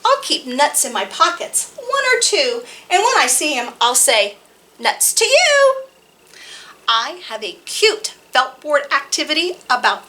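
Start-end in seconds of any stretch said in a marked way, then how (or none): 4.8-5.8 high, loud call with a long you. A narrator's reading. Child calling to the squirrel, high and loud. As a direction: sing out the taunt.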